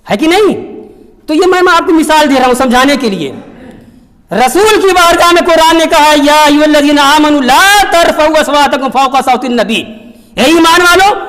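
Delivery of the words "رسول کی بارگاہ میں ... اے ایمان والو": loud, higher preaching voice; fervent preaching. Acted. Preacher warning believers about the Prophet's court, loud. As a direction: proclaim it to the whole hall.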